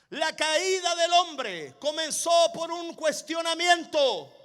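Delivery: forceful, emphatic sermon delivery; emphatic preacher building the warning; full-force preaching. Real, not acted.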